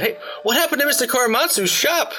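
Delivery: hurried dad question. Acted. GM voices father asking.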